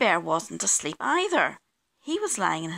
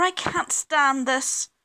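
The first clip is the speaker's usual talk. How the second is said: higher, louder, stretched complaint; exasperated bear; 6 semitones higher, 5 dB louder, slower than usual. A narrator's reading. Mister Bear disturbed by kitchen noises, exasperated. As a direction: draw it out, fed up.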